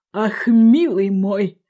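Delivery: put-on slow, moralising old crab. A narrator's reading. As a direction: lecture a child ponderously, drawing words out. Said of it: "А старый краб". (медленно, назидательно, как старый краб)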